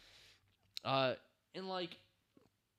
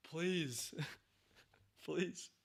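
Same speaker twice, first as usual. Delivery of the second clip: higher, softer, put-on begging please, laughing at the end; higher mock plea; mock pleading; 4 semitones higher, 9 dB quieter than usual. Real, not acted. Begging for scary show.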